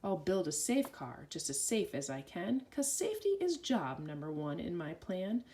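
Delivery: plain reading voice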